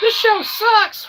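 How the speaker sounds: very high, slow shouted heckle; mock heckling mom. Host plays his mom heckling the show, very high slow shout. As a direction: jeer at the show like a nagging mom. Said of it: "Keep those comments to yourself, Mom".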